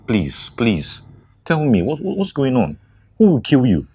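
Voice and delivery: high, loud, urgent demand; alarmed shout. Acted. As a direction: burst out in alarm. Friend demands who threatens her.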